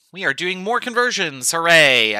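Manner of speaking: mock cheer, loud and bright; sarcastic hooray. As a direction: fake enthusiasm for a dull topic. Sarcastic cheer about conversions.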